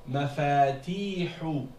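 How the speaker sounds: mafatihu slowly, "faa" and "tii" held long; long alif and ya. Shown as a demonstration. Teacher repeats the word, mafatihu with long alif and ya. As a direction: draw out both middle vowels of mafatihu. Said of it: "c'est le alif et le ya".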